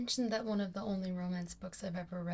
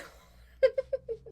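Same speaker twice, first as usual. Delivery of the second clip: very high, loud giggling burst; laughing fit; 9 dB louder than usual. Real, not acted. Vlogger loses straight face at book title, laughing.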